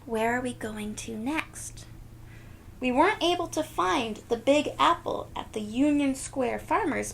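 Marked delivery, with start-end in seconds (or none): none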